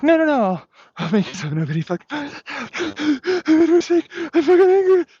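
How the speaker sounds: high, loud mock whine; mocking quote. Speaker mocking the man who wronged him, high whiny mock voice. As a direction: parody the other person's flustered excuses. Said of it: "But then it's like".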